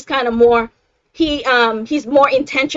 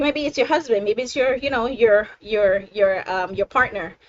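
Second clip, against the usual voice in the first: ordinary talk, nothing marked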